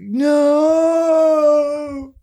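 high, long hammed-up wail; mock wailing no. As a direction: wail the word like a grieving dad. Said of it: "He's like".

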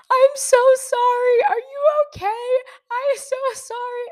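very high, loud mock sobbing wail; crying even harder. Driver apologizing to guy she hit, crying even harder. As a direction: re-enact a bawled, squealing apology. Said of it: "start crying even harder".

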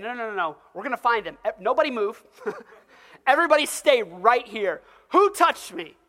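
preacher acting Jesus ordering the crowd to stay put, loud; Jesus insisting. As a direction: stop everyone dead, then demand an answer.

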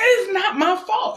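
reenacted anguished protest; retold protest. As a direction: insist it's not your fault, half-reliving the scene. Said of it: "I'm like".

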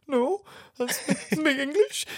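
high, slow, giggly answer; mock-innocent high voice, laughing through it; laughing reply. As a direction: break into laughter mid-line. Retelling a German set.

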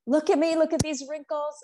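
high whiny mock complaint; mock complaining friend. Friend frets about her wrinkles in a high mock whine.

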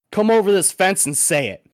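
mock fighting words; retold challenge. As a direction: throw it back at a heckler, imitating the driver. Retold driver challenging a heckler.

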